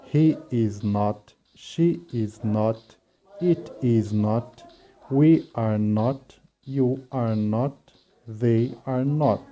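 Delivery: slow, clear teacher drill; deliberate classroom pacing. Shown as a demonstration. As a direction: lay out each form slowly for students. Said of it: "Então vai ficar".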